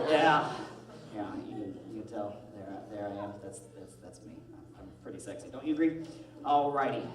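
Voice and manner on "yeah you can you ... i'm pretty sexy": low, soft, self-admiring murmur; mock sexy. Host boasting to the crowd about his looks, mock sexy. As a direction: drop low and quiet, playing irresistible. Plays the sexiest man on earth.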